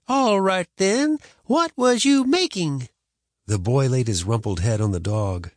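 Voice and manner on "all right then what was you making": higher, louder demanding granny; stern grandma. A narrator's reading.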